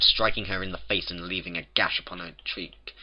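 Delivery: usual speaking voice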